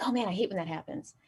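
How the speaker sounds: boss. Retold or imitated. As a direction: mumble a casual small-talk gripe as the boss. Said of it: "and he's like".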